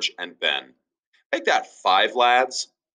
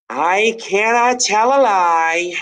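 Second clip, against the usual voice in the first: high, slow solemn confession; earnest boy; 10 semitones higher, 6 dB louder, slower than usual. Acted. George confessing to his father, high and slow. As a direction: own up with noble gravity. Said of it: "answered George".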